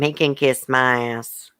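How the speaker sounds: ordinary talk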